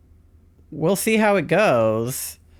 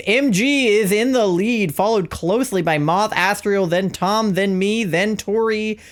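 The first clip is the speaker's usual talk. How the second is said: high, bright race-caller delivery; announcer voice; 5 semitones higher than usual. Pretend. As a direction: call the race like a sportscaster. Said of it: "let me do my announcer voice".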